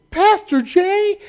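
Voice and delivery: calling out. Retold or imitated. People calling across a crowd.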